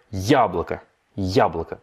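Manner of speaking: яблоко with я stressed and the rest reduced. Shown as a demonstration. Teacher insisting on the stressed я for the class. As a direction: press the first syllable of яблоко. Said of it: "il est sur le ya".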